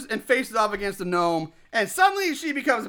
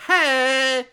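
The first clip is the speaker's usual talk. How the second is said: cheesy sitcom hey; 4 semitones higher than usual. Retold or imitated. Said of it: "they look at the camera like".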